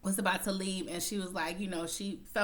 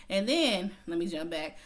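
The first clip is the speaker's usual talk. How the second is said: ordinary talk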